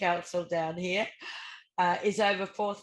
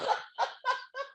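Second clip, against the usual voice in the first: high, helpless burst of laughter; real uncontrolled giggling fit; laughing. Real, not acted. Co-host cracking up at the council funding figure, laughing. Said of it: "I've got to laugh".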